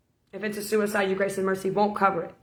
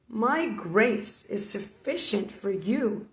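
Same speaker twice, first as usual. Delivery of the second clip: Jesus speaking; 4 dB quieter, much slower than usual. Retold or imitated. Jesus voiced in a testimony.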